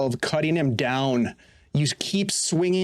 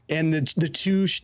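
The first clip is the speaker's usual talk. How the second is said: ordinary talk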